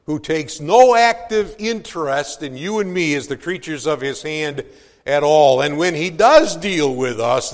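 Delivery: preacher swelling toward wrath; rising preaching. Acted. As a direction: climb in force toward God's anger. Preacher builds on God's wrath.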